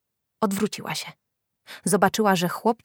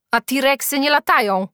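put-on louder, higher boy's voice; freckled boy chiming in; 7 semitones higher, 6 dB louder than usual. A narrator's reading. Freckled boy challenges Julka's story, chiming in loudly. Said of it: "dodał piegowaty chłopak".

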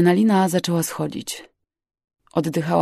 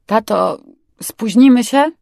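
raised, louder anxious question; nervous question; 5 semitones higher, 7 dB louder than usual. A narrator's reading. Daughter asks dad about being late, nervous. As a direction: ask it on edge, pitch lifting. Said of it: "zapytałam podenerwowana".

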